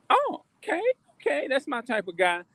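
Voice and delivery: high-pitched mock woman's voice, dropping partway through; imitating approving women. Mimics women approving a man's job.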